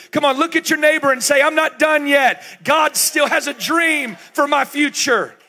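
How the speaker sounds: loud, ringing preacher's call to the congregation; full-force preaching. Acted. Pastor rousing older folks in the room, full-force preaching.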